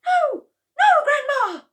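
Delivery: very high, very loud panicked cry; cried out. A narrator's reading. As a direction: pull the cup away in alarm. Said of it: "George cried out".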